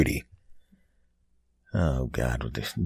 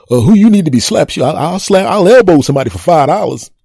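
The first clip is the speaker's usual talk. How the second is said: eager offer; 10 semitones higher, 9 dB louder than usual. Acted. Eager hustler cuts the price.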